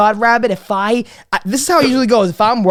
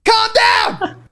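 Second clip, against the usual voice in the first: very high, pleading holler at mom; exasperated yell; 13 semitones higher, much slower than usual. Retold or imitated. Son cutting off mom's questions, exasperated yell. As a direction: cry out to stop a pestering mother. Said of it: "I'm like".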